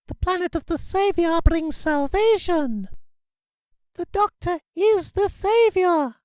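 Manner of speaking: high, squeaky alien chanting; squeaked shouts. Acted. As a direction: squeak the slogans out, slow and devout. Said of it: "Several shouts squeaked out".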